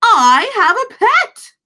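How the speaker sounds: high thrilled fish voice; excited glub. Acted. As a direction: shout it with pure delight. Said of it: "Gilbert glubbed".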